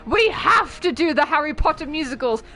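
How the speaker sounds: hammed-up teenage protest; mock teenage outrage. Teens demanding a musical from the director, mock outrage. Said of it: "we were like".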